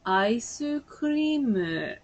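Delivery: drawn-out Japanese "aisu kuriimu"; Japanese pronunciation. Acted. Speaker repeating it to puzzled people, in Japanese pronunciation. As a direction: say Ice cream with Japanese vowels after each consonant. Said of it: "it's actually Japanese".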